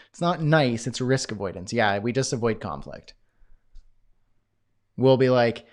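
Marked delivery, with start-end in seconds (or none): none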